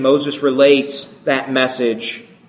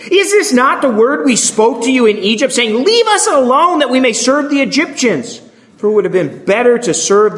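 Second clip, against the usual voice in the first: much higher, whining accusation; complaining crowd voiced high and aggrieved; whiny complaint; 9 semitones higher than usual. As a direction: whine it like frightened, bitter people. Israelites complaining to Moses.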